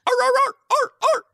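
high yappy mock barking; imitated dog barks. Showing friends what dogs sound like, high yapping barks. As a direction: yap like a little dog. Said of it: "dogs sound like this".

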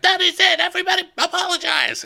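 very high, comic royal outburst; hammed-up king losing patience; mock exasperated yell.

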